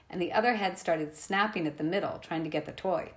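usual speaking voice

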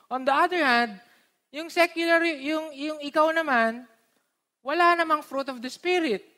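ordinary talk, nothing marked

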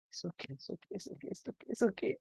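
husband soothing his frightened wife in a whisper. Retold or imitated. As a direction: whisper comfort to calm her.

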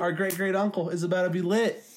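high, eager announcement; hyped up. Pretend. Promising the uncle a party, hyped up. Hyped punchline of imagined plan.

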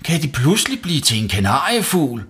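put-on high, loud boy's protest; shouting back. A narrator's reading. Savi to mum in the bathroom, shouting back. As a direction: call back excuses at full voice. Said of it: "råbte Savi tilbage".

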